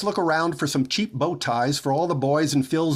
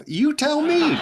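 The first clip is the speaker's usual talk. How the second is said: 1 laughing; 11 semitones higher, slower than usual. Real, not acted. [name] breaks up mid-argument.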